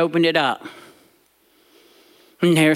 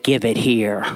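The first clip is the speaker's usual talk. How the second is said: low, firm granny; granny demanding; 9 semitones lower than usual. Retold or imitated. Granny taking the boy's money, low and firm. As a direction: hold out a hand and demand it. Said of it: "she held out her hand and said".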